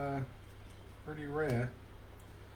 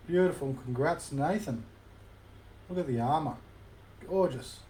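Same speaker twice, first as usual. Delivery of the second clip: usual speaking voice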